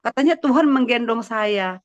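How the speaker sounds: retold complaint to God. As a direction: replay a puzzled protest in prayer. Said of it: "Saya bilang". (menirukan keluhan kepada Tuhan)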